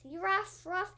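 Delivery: light spoken dog bark. Acted. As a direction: say it like a small bark. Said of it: "Ugly Brother barks".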